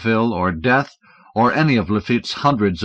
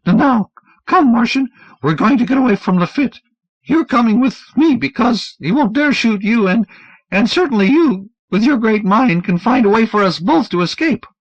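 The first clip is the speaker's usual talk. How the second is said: high, urgent stammering plea; 13 semitones higher, 5 dB louder than usual. Acted. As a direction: plead fast and nervous.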